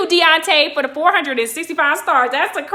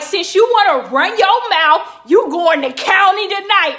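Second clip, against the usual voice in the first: loud, higher-pitched mock authority; cop's threat; 6 semitones higher, 6 dB louder than usual. Cop sending a mouthy bystander to county, loud threat. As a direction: lay down the law loudly.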